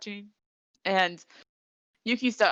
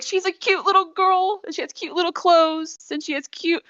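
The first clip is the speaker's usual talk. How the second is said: much higher, gushing sweet voice; gushing mother; 12 semitones higher, 4 dB louder than usual. Retold or imitated. Frantic mother describing her missing girl, gushing high. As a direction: coo over the cute little girl. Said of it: "she says all these cute things".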